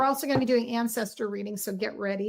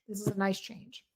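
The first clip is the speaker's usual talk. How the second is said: quieter, to herself; softer repeat; 3 semitones lower, 7 dB quieter than usual. Real, not acted. Reader softly repeats the good news to Capricorns. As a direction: echo it softly, a gentle afterthought. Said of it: "So this is a nice change".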